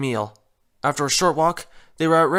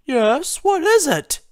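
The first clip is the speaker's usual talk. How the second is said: much higher, lilting Rarity voice; 11 semitones higher, 4 dB louder, slower than usual. Acted. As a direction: pitch way up for the lady.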